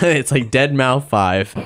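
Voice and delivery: slow, amused repeat through a laugh; chuckling as he echoes the joke. Real, not acted. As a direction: laugh the words out slowly.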